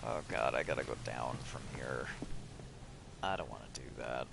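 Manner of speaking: reluctant whisper under her breath; barely voiced, dreading the drop down. Real, not acted. Reluctant at a ledge edge.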